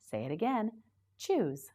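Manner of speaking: normal talking voice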